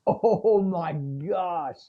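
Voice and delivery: very slow, drawn-out dawning dismay; stunned realization. Retold or imitated. Dad caught by his four-year-old's rebuke, slow stunned gasp of words. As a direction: let it sink in slowly, caught out.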